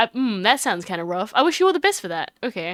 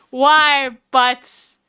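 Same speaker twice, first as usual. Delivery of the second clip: ordinary talk, nothing marked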